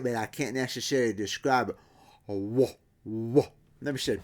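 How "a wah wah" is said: odd rounded wah sounds; imitated woof. Shown as a demonstration. Teacher attempts to imitate the woof sound. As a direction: try to imitate the hidden tone. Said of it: "I can sort of imitate it".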